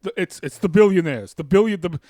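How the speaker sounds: put-on rally the crowd like a fiery old senator; mock campaign-trail indignation; Bernie Sanders. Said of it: "I am Bernie Sanders".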